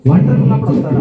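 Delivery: startled loud. Acted. Preacher reacts to spirit story.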